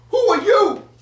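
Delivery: very high, loud shriek; shrieking Lakeisha. Retold or imitated. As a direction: screech it at the wife in outrage.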